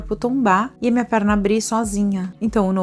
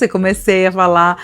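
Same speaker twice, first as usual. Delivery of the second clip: usual speaking voice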